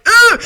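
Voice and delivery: loud groan of disgust; disgusted ugh. Real, not acted. Reader cringing at the quote, disgusted groan.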